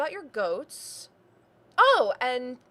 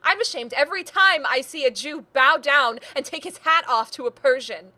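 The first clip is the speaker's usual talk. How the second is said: loud, raised-pitch indignation; impassioned outburst; 4 semitones higher, 6 dB louder than usual. Acted. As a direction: let the shame pour out as a loud tirade. Acted quarrel, niece's angry outburst.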